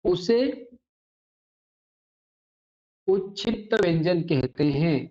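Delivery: teacher dictating a definition slowly with long pauses; slow dictation. Acted. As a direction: read it out word by word for pupils to write. (धीरे-धीरे परिभाषा लिखवाना)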